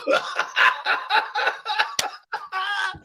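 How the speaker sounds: real helpless laughter; high cackling laugh. Real, not acted. Cracking up at his cohost's travel gadget, high laughing fit. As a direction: burst into a long high laugh.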